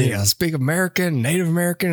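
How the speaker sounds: put-on grumpy Southern old man. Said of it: "we always devolve into that guy".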